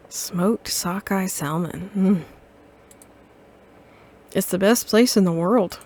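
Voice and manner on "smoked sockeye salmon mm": slow and soft, savoring each word; dreamy savoring. Acted. Character longing for home, slow soft savoring. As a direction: say it like you can taste it. Savoring the dish name again.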